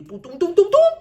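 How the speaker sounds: imitated phone ring. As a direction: hum the ringtone like a phone calling out.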